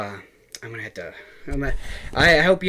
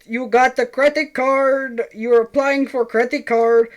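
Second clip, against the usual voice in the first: much higher mock Indian caller accent; Indian scam caller accent; 12 semitones higher, 4 dB louder than usual. Retelling a scam call, mocking the Indian caller in a higher accent. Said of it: "like, an Indian guy".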